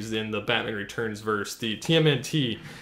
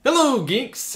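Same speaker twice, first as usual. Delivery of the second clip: excited greeting; 12 semitones higher, 4 dB louder, slower than usual. Real, not acted.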